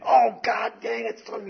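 mock the brother's yelp of pain; pained cursing. Brother hit by a BB, cursing in pain.

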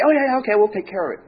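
high-pitched, hasty reassurance; put-on clerk brushing off a complaint; placating clerk.

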